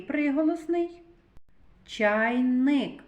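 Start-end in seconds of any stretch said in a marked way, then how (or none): 1.9-3.0 "Чайник" with a long held Н; sound Н emphasized. Shown as a demonstration. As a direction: hum through the Н in "Чайник". («Чайник» із протяжним Н)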